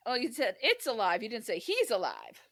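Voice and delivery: normal talking voice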